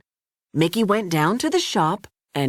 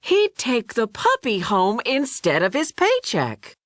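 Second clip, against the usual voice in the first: usual speaking voice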